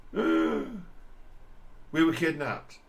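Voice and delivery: very slow, blubbering crybaby whine; mock sobbing. Commentator mimicking the woman claiming she was kidnapped, mock sobbing. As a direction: drag it out like a sobbing little kid. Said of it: "Sobbing like a little babe".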